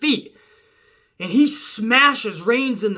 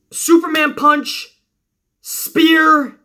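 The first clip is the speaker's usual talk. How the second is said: rising, louder play-by-play call of the moves; excited call; 7 semitones higher, 6 dB louder, much slower than usual. Retold or imitated. Fan calling Reigns' finishers, hyped and louder.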